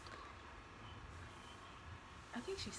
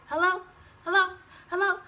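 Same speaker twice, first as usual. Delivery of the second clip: raised, louder phone hello, imitated; mom calling hello; 8 semitones higher, 8 dB louder than usual. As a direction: call out as a mom checking who's on the line. Mom answering the phone, acted.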